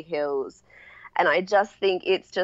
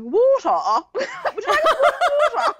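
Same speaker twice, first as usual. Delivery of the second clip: imitating a British dad offering water, much higher and laughing; posh British host voice, pitched way up and giggling through it; British accent, dad offering a drink with a laugh; mock British accent; 4 dB louder, slower than usual. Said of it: "'Cause British".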